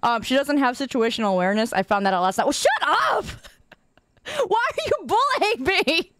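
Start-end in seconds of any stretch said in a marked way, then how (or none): 2.4-6.1 high-pitched, laughing protest; mock outrage. Streamer to teasing chat, mock outrage. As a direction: play the wounded victim through laughter.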